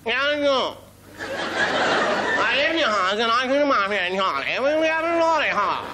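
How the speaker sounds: high, strangled upper-class caricature; upper-class English accent. Acted. Comic mocks the supposed upper classes, strangled upper-class English accent. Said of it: "supposed upper classes".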